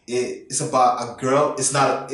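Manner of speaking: normal talking voice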